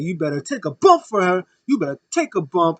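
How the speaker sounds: mock heel threat. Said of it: "He's like".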